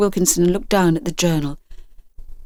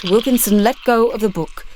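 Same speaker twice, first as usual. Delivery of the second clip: plain reading voice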